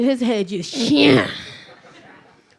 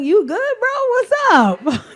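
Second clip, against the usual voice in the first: concerned mom; 10 semitones higher, 6 dB louder, slower than usual. Retold or imitated. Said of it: "I'm like".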